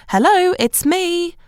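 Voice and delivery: high, slow called-out hello; yelled greeting. A narrator's reading. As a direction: call it out through the house.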